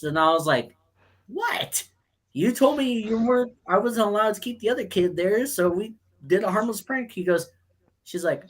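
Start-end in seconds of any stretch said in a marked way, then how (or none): 1.3-1.8 high-pitched, soft squeal of disbelief; incredulous what. Retold or imitated. Acting out shocked reply to teacher. 2.3-7.1 high-pitched, defensive retold excuse; mock protest. Retelling own protest to the teacher.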